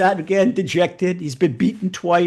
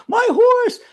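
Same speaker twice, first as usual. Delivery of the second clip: high-pitched hammed-up wail; distraught cry; 4 dB louder than usual. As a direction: act out someone frantic over a loss. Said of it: "He's pulling his hair out".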